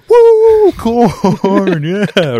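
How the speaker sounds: high, loud whoop of joy; cheering. Retold or imitated. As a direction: cheer it like a fan at a game. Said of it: "cheering my heart out for corn".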